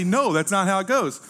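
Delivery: emphatic denial. Retold or imitated. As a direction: insist it's not so, pressing each word. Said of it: "tell him emphatically".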